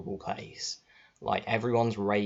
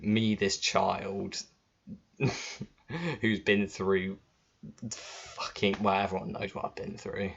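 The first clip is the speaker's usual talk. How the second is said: usual speaking voice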